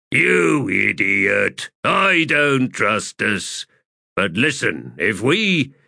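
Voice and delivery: slow, scornful corporal voice; irritated corporal. Acted. Corporal scolding the shaman over munitions, irritated. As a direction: stretch out the scolding.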